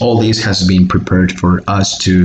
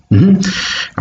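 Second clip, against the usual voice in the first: ordinary talk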